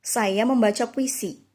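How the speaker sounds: "Saya membaca puisi" as a firm exclaimed order; command intonation. Shown as a demonstration. Teacher showing the third sentence with an exclamation mark, "Saya membaca puisi" in firm command intonation. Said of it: "menggunakan tanda seru".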